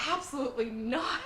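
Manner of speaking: mock indignant refusal. Boy denying his feelings for the girl, mock indignant. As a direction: reject the idea, imitating a flustered boy. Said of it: "he's just like".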